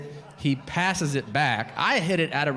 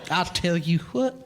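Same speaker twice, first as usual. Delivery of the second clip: higher, hammed-up cowboy twang; cowboy persona; 4 semitones higher than usual. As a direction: play a self-styled cowboy making a pronouncement. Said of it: "adopted the cowboy persona".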